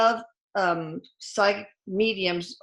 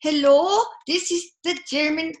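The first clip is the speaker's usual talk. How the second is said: high-pitched, badly faked German accent; 7 semitones higher, 4 dB louder than usual. German Coast Guard answers the radio, clumsy German accent. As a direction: put on a poor German accent. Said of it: "I can't do a German accent".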